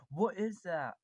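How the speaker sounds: freaking out. Retold or imitated. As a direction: act out a viewer's jolt of surprise. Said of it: "you are probably freaking out".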